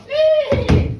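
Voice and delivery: high, loud angry whinny; angry horse neigh. Acted. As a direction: neigh furiously at full voice. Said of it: "he was an angry horse".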